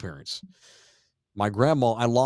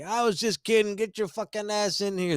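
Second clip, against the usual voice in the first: high mock pleading husband; mock drunk husband; 9 semitones higher than usual. Husband calling his wife back inside, high hammed-up coaxing. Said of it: "He's like".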